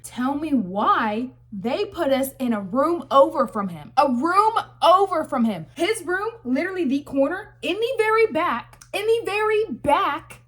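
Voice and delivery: loud, rising shocked outrage; half-yelling in disbelief; outraged disbelief. Real, not acted. Outraged at the room placement.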